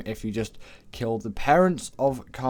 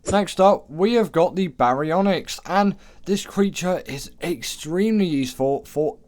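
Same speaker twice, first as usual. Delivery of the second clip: hype announcer; 7 semitones higher, 5 dB louder than usual. Acted. Countdown entry announcement.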